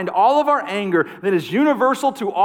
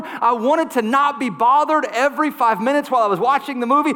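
higher, insistent sermon list; building preaching; 5 semitones higher than usual. Acted. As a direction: stack each wanted like a charge.

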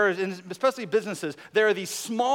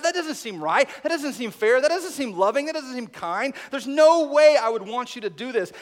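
protesting; 5 semitones higher, 5 dB louder than usual. Pretend. Preacher as Habakkuk protesting.

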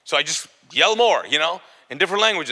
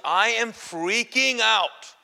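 very drawn-out, raised pitch; mock freak-out; 6 semitones higher, much slower than usual. Demon getting closer to him, drawn-out mock panic. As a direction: stretch each word in comic panic. Acting out rising panic for laughs.

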